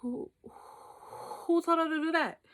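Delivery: slow, drawn-out God voice; puzzled God. Acted. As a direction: ask slowly as a baffled God. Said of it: "And God was like".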